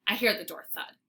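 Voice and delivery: ordinary delivery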